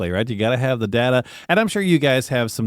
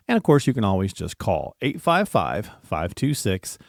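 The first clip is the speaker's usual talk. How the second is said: ordinary talk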